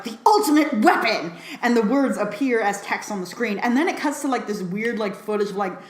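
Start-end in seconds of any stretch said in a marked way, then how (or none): 0.0-1.3 big, louder, higher announcer voice; old master proclaiming the weapon's name, hammed-up; dramatic announcement. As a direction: proclaim it like a movie title.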